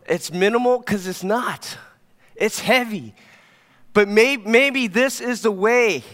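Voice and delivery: high, loud, impassioned preaching; fired-up preacher rallying the church; preaching at full force. Acted. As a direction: build the sermon to its peak. Preaching climax.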